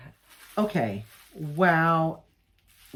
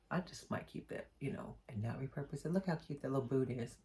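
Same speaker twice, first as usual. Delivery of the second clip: softer, half-whispered to herself; hushed aside; 4 semitones lower, 14 dB quieter than usual. Real, not acted. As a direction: murmur it like a little secret. Keeping a piece for herself.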